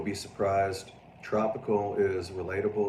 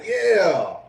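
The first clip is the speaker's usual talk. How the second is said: laughing retort; 5 semitones higher, 9 dB louder, slower than usual. Retold or imitated. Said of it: "I was like".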